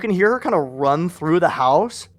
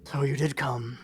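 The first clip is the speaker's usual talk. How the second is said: low scratchy woman; 3 semitones lower, 10 dB quieter than usual. Acted. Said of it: "low and scratchy 'cause she was sick".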